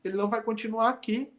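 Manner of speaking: high, irritated principal brushing them off; angry principal. Retold or imitated. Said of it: "esse diretor ficou bravo".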